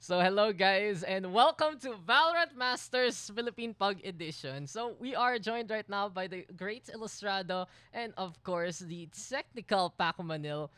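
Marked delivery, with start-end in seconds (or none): 0.0-6.5 loud, high esports caster intro; announcer voice. Acted. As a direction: hype up the show opening. Opens the show as caster.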